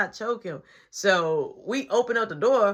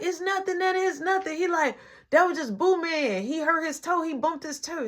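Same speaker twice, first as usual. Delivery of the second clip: higher-pitched, hasty excuse; raised-pitch fibbing; retold cover-up; 8 semitones higher than usual. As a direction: imitate someone calling out an innocent excuse. Brother covers up to nana.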